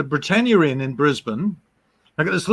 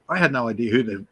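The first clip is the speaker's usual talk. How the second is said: normal talking voice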